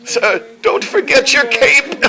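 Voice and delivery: high, drawn-out dying cry with a laugh in it; dying demon general. Acted. Demon general dying, calls to his master, hammed-up high wail. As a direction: wail it loud and high, hammed-up last words. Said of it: "he's dying, and he's like".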